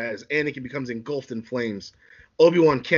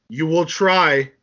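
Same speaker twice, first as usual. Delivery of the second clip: slow, drawn-out taunt, louder; menacing Anakin; 3 semitones higher, 5 dB louder, much slower than usual. Acted. Anakin challenges Obi-Wan to a duel, slow taunt. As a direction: stretch it into a challenge.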